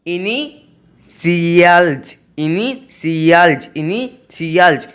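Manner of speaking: इनिशियल्स drawn out slowly, syllable by syllable; slow word demo. Shown as a demonstration. Teacher modelling इनिशियल्स slowly for the class. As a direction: say इनिशियल्स very slowly for students to copy. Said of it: "मतलब होता है हस्ताक्षर".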